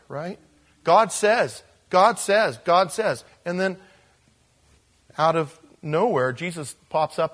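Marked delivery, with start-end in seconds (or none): 0.8-3.2 GOD punched loud and high each time; emphatic God says. Retold or imitated. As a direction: hit "God" hard, three times. Prophets' refrain, emphatic.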